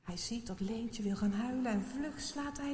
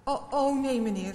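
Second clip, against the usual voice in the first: flustered louder stammer; 3 semitones higher, 7 dB louder than usual. A narrator's reading. Maarten stammering to the helper in alarm. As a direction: trip over the words in panic. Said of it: "stottert hij".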